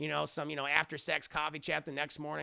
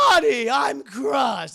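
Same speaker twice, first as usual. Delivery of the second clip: loud, high, drawn-out mock hurt; theatrical shouted villain lament; Joker; 10 semitones higher, 13 dB louder, slower than usual. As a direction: wail it slowly like a hammy villain. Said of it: "That's what he says".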